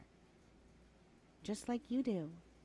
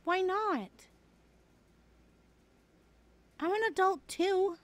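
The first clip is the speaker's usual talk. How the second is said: higher, louder defensive comeback; indignant protest; 8 semitones higher, 6 dB louder than usual. Real, not acted. Defending her swearing to a laughing friend, indignant. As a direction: insist you're a grown-up. Defensive after saying fuck.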